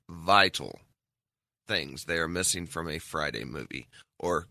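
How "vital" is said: vital low, loud and falling; heavy stress on vital. Shown as a demonstration. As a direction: hammer the word vital. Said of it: "and I can't stress this enough".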